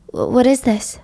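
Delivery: breathy frightened whisper; hushed whisper. Acted. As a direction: whisper it, afraid of the box. Sonny opens the mysterious box.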